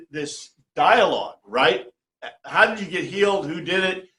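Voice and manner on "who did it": normal talking voice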